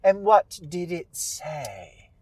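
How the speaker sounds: put-on old witch drawing out a pointed question; Holcomb. Witch testing Lara about the tree, slow drawn-out witch voice. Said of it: "And Holcomb says".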